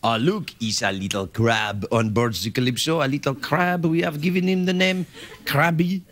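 put-on a Jacques Cousteau French accent, hushed and wondering; Jacques Cousteau impression. To the audience, as Cousteau naming a crab, French accent. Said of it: "best Jacques Cousteau impression".

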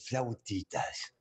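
slow, breathy whispered "Flautitas"; Dracula's whisper. Retold or imitated. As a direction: hush it out slowly like a sinister count. Dracula orders at the bakery.

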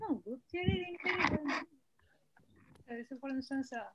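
very faint, far-off voice; speaking much too softly to carry; barely audible. Real, not acted. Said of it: "Ma'am, your voice is very low".

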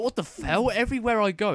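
high, loud exasperated yell; frustrated outburst. Retold or imitated. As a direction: let the frustration burst out. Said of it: "I was legitimately getting angry".

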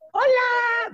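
put-on very high little boy's "Hola"; child's hello. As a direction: chirp it like a small kid. Jaimito greets the couple. (Jaimito saluda con voz de niño muy aguda)

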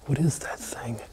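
hushed wary whisper. Real, not acted. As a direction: whisper the question nervously. Spots something in dark basement.

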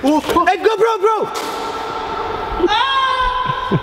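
high, urgent yells; excited shouting. Real, not acted. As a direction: yell in rising panic. Panic shouts in the sling.

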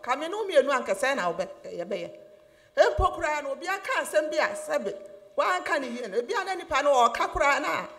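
loud, fervent sermon, rising and pressing; preaching at full force. Acted. Pastor urging the congregation to trust Jesus, preaching at full force. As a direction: preach it hard to the church.

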